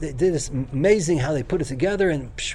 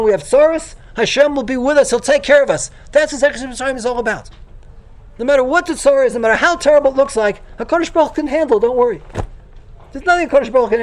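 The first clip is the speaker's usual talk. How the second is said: louder, higher reassuring sermon build; fervent preaching; 6 semitones higher, 4 dB louder than usual. Acted. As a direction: lift the room with rousing comfort. Preaches rising comfort.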